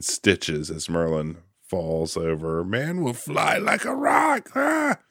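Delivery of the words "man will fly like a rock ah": high, hooting owl mockery. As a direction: shout it up high like a cackling owl. Quoting Archimedes' mocking line.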